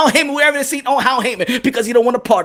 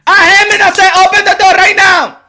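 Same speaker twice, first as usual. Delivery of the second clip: put-on high, loud accented shout; Manny Pacquiao shouting; 6 semitones higher, 6 dB louder than usual. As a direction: escalate to an impatient yell at the door. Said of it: "Manny Pacquiao was, went to the office".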